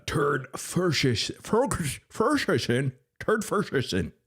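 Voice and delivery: "Ferguson" garbled as "Fershuson", high-pitched; high mock botching of "Ferguson"; mocking mispronunciation. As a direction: stumble mockingly over "Ferguson" with a sh in the middle. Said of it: "mispronouncing certain words".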